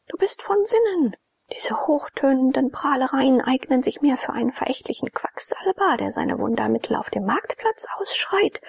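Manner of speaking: a proud girl mocking his boasts; Jone scornful. A narrator's reading.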